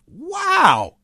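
a sudden high amazed wow; surprised wow. Real, not acted. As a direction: gasp it out, truly astonished. Amazed at brawl ending game.

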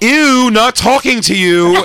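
put-on a kid snubbing a stranger; mock disgust, drawn out; snotty kid. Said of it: "he went".

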